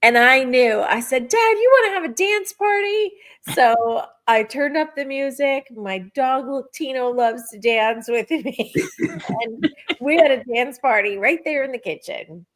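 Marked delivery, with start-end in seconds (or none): 1.3-3.1 high, bright playful call; excited invite. Retold or imitated. To her late dad, inviting a kitchen dance party, high and excited. 8.8-9.4 a burst of high giggling; high giggle. Real, not acted. Dancing in the kitchen with her dog, high giggle.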